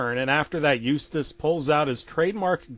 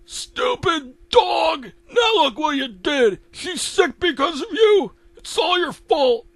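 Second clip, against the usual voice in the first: loud, very high angry shout; Eustace blaming; 14 semitones higher, 5 dB louder than usual. Acted. Eustace blaming the dog for his wife's illness, angry.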